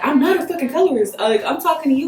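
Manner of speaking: hammed-up defensive date denying colorism; mock defensive date. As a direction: play him getting defensive and pushy. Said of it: "he was just like".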